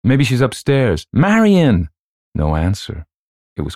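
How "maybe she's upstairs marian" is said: high, loud, building to a shout; calling out. A narrator's reading. Someone searches for the missing child, calling out. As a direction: raise it into a call upstairs.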